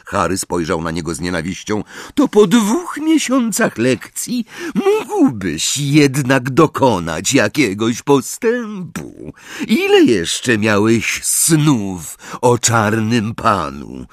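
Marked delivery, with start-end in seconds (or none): 2.2-14.1 scornful teacher, slow and high-pitched; Snape. Acted. Teacher scolds a pupil over his poor progress, slow scorn. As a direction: draw out the reproach in a raised, taunting voice. Voices Snape berating Harry.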